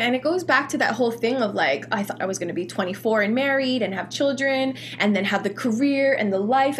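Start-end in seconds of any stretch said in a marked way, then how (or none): none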